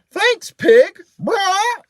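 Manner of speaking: high, loud, squawky parrot; a cheeky bird squawking thanks; rude parrot. A narrator's reading. Parrot snatches cookie, squawks thanks.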